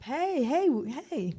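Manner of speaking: high, sing-song hey; wake-up call. Retold or imitated. Waking her sleeping cousin.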